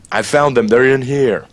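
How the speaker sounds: a half-held shout imitating a party member; mock yell. Player imitating a comrade to lure the others, mock yell. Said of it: "Scream in, uh, the voice of one of their comrades".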